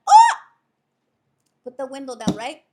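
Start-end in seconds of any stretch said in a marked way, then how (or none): 0.1-0.3 loud, high "oh!" whoop; singer's whoop. Pretend. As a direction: cap the song with a whoop. Whoop capping the sung line.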